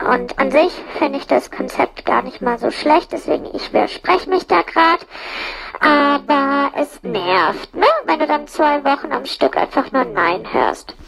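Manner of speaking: ordinary talk, nothing marked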